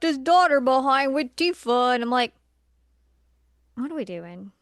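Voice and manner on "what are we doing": ordinary talk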